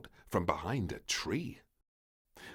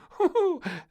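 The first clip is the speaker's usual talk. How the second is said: put-on little girl's high, loud giggle; 11 dB louder than usual. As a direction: giggle with glee. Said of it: "giggled Little Princess".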